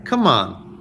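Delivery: ordinary talk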